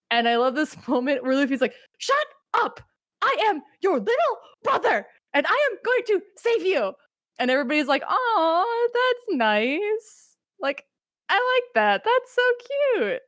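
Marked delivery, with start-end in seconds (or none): 2.0-6.9 put-on much higher, defiant kid voice; high-pitched determined younger brother; Luffy. Voicing Luffy's declaration. 8.1-13.2 much higher, cooing mock-sweet voice; high, gushing fond aside; cooing onlookers.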